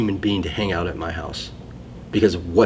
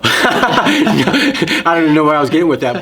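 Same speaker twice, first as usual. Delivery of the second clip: loud, high, laughing through the words; real laughing; 8 semitones higher, 9 dB louder than usual. Real, not acted. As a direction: crack up at losing your own thread. Loses thread and bursts out laughing.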